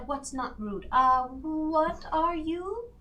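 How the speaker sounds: ordinary talk, nothing marked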